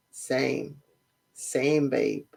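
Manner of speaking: low, lacklustre imitation; falls flat. Mocking her answer to his loving words, falls flat. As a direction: drop it low like a half-hearted reply. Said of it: "it just falls flat".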